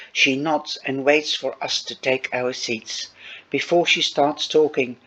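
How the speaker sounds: usual speaking voice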